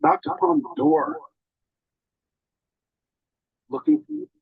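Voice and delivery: telling how he searched, voice breaks with feeling; emotional voice breaking. Real, not acted. Choked up, voice cracking high. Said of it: "I'm very emotional right now".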